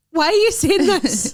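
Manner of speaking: high, loud plaintive protest; mock distress. Retelling her reply about a bad photo, mock distress. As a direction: wail it in comic outrage.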